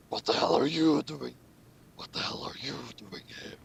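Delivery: hushed, breathy-free whisper of a startled villain; mock startled whisper. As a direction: whisper it in shock. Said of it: "said Saber back".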